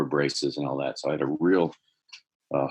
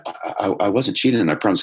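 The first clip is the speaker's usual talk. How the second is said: higher, louder retold panicked protest; panicked stammer; 6 semitones higher, 6 dB louder than usual. Student caught by his teacher, panicked stammering denial. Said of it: "I immediately felt that panic".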